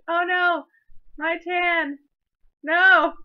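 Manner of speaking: high, loud wail of dismay; mock dismayed wail. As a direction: wail it like your tan is ruined. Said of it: "and I'm like".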